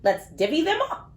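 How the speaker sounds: put-on lively, sing-song storybook read for little kids; reading to children. A narrator's reading. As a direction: read it like bedtime story time for small children. Reads picture book to kids.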